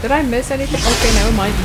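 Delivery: ordinary delivery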